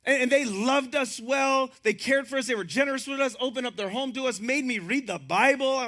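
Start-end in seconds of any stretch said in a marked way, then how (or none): none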